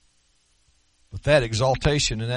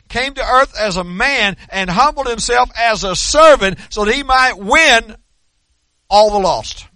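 loud, raised, emphatic sermon delivery; full-force preaching; 6 semitones higher, 9 dB louder than usual. Real, not acted. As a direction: proclaim it to the whole congregation, punching key words.

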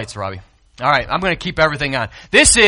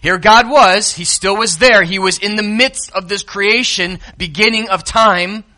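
ordinary delivery

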